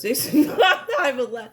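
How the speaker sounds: high, laughing through the words. Real, not acted. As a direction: try to talk while cracking up. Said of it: "yo, I'm not laughing".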